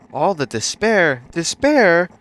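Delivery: loud, high dramatic wail; anguished, over-the-top shout; despairing cry. A narrator's reading. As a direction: belt it out in despair. Acts out letting out despair.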